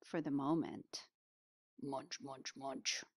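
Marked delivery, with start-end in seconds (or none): 1.8-3.0 very soft, low munch sounds; quiet munching. Acted. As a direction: murmur it like a sneaky snack. Bear munching at the end.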